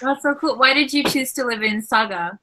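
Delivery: ordinary talk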